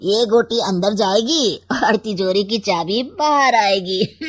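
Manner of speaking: usual speaking voice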